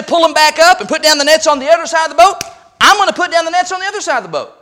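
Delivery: fervent submission. Acted. Disciple pledging obedience to his Lord, fervently.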